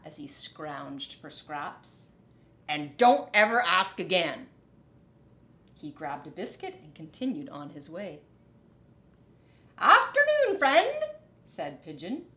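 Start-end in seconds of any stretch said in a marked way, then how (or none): 2.7-4.5 louder, curt grumpy reply; gruff rat brushing off Mouse; grumpy snap. Acted. 9.8-11.0 much higher, loud sweet bird voice; high friendly pigeon greeting; cooing pigeon. Acted.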